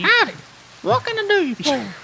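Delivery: very high, squeaky howdy; squeaky tiny critter voice. Acted. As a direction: squeak it like a foot-tall wolf. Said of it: "he can still have a deep voice".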